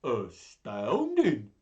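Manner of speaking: loud, slow monster wonder; astonished Gruffalo. Acted. Gruffalo impressed by the mouse, astonished monster voice. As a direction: stretch it out in amazement. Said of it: "said the Gruffalo".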